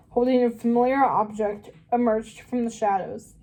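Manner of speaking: plain reading voice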